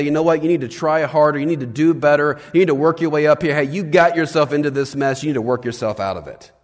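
loud, harsh scolding from above; barking demand. Retold or imitated. Pastor imitating a distant God scolding people, loud bark. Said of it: "bark out and say".

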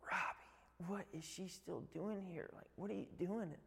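a hushed, puzzled dad on the stairs; confused dad. Retold or imitated.

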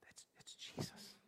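breathless whispered realization; stunned hushed recognition; awed whisper. Acted. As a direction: whisper it in awe. Disciples realize in awe.

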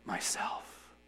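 whispered so softly it's hardly heard; barely audible whisper. A narrator's reading. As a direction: breathe it like rustling leaves. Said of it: "whispered so softly you could hardly hear it".

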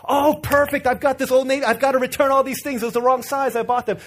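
eager mom voice. Retold or imitated. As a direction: play the overeager mom jumping at the chance.

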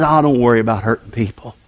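low, fast, dismissive tempter; a hint of a sly tempter; Satan's voice. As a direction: brush it off like the devil would. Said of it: "Satan says".

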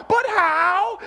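high, drawn-out protest, rising in disbelief; protesting doubter. Acted. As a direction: act out a listener balking at God. Imagined listener protesting to God.

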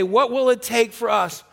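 usual speaking voice

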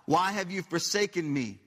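the preacher declares the psalm in a lifted voice; proclaimed psalm. Shown as a demonstration. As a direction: read the psalm aloud, building in pitch. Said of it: "Here's what it says".

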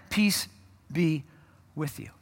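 slow, soft, spaced-out blessing; calm blessing. Acted. Jesus calming his scared disciples, soft and slow. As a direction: let each word settle gently.

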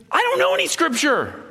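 high-pitched panicked yelp, imitated; high, hammed-up alarm; mock freaking out. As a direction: imitate a friend losing it on the phone. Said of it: "he's just yelling into the phone".

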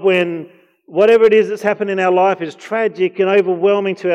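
loud, high, impassioned sermon voice; fervent preaching. Acted. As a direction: preach it out to the whole room. Preacher builds again.

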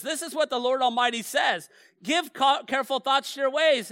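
loud and high, each word driven home; booming scripture read. Shown as a demonstration. Pastor to church, the Lord's words thundered out loud. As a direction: proclaim it like a prophet. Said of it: "he says".

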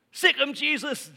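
high, loud shrieking bird command; parakeet's shout. Acted. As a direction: sic the dog on him like a bird gone wild. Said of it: "the bird goes".

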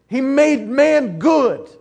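slow it right down and lean on good; drawn-out repeat. Acted. Preacher to the congregation, repeating it slowly with weight on good.

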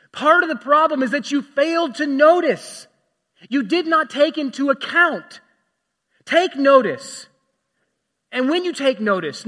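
loud, slow and high, pleading with the church; impassioned preaching as Jesus. Acted. Preacher voicing Jesus urging listeners to notice their sin, fervent and loud. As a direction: urge them to stop and look at themselves. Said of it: "Jesus is in effect saying".

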